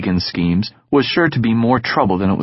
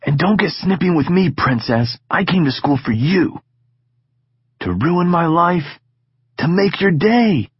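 put-on lightly higher, teasing young friend; Egan's voice, cocky; 6 semitones higher than usual. Egan needling Sid about school, teasing. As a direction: tease your friend about his tone.

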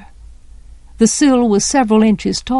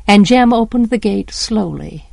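plain reading voice

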